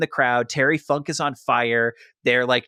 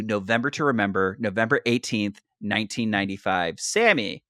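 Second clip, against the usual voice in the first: ordinary talk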